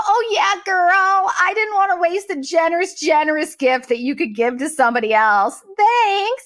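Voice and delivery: drip sarcasm as if being gracious; sarcastic sweetness. Retold or imitated. Sarcastic reply to the woman.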